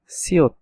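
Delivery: সিওত said as Korean "si-ot"; teacher naming the letter সিওত; Korean letter name. Shown as a demonstration. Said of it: "এরকম একটা চিহ্ন যেটা হচ্ছে".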